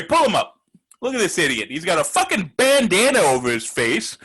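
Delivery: ordinary talk, nothing marked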